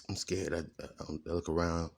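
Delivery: normal talking voice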